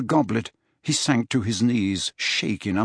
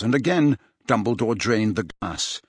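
plain reading voice

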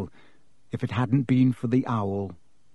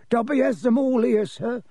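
high, small elf voice; high-pitched, apologetic elf; pleading house-elf; 10 semitones higher than usual. Acted. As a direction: plead nervously as a little creature. Dobby answers in figure voice.

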